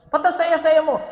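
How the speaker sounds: bright rhetorical teasing; playful question. Acted. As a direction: tease them with the question. Repeated teasing question, raised.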